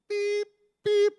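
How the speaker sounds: high, loud mimicked bleep; imitated censoring tone; censor beep. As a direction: squeal a bleep over the swear words. Bleeping out spotter's swearing.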